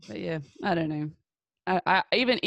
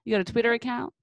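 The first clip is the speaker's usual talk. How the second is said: put-on "Twitter" in a playful American accent, higher; 6 semitones higher, 4 dB louder, faster than usual. Host echoing the American guests' word "Twitter", in an imitated American accent. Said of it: "with your American accent".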